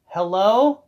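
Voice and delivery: naive, loud high "anyone home" call. Acted. Acting someone in a dark locker room, calling out.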